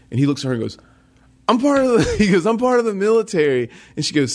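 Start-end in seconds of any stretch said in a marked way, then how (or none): 1.5-2.5 high, loud imitation of an indignant Marine; indignant cousin. Cousin pulling rank at border. 2.5-3.7 high, mock-indignant imitation of a Marine; indignant cousin. Cousin pulling rank at border.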